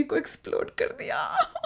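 quiet nervous giggle through words; weird laugh. Real, not acted. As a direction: laugh awkwardly while confessing. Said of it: "मुझे अजीब सी हंसी आ रही है".

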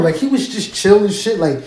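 ordinary delivery

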